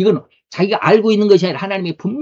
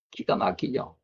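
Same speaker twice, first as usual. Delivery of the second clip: whispered aside; 5 semitones lower, 10 dB quieter, slower than usual. Real, not acted.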